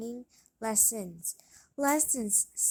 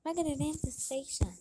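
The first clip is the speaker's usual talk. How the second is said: plain reading voice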